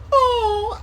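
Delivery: high, loud pretend sob; mock crying wail. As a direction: burst into a fake high wail. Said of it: "bust out in full tears".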